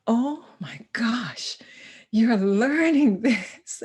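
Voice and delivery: slow, hushed amazement; awed realization. Retold or imitated. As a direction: let the realization dawn slowly. Awed inner thought.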